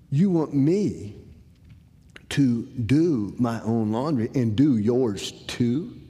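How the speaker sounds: slow, deliberate, stressing each demand; slow disbelief. Retold or imitated. Son repeating dad's rules back to him, slow and incredulous.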